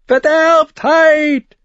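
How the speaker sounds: piercing, high, drawn-out cry; shrill scream. A narrator's reading. Prophet warning a crowd in the square, shrill scream. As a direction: shriek it slowly like a raving prophet. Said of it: "schrie der Mann schrill".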